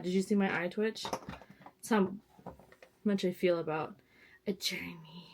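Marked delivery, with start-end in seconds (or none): 4.5-5.3 "journey" softly, low, with distaste; mocking buzzword. Vlogger shows her disgust at the word, mocking it softly. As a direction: mutter "journey" in mock imitation of online influencers. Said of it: "it's a specific way people say journey".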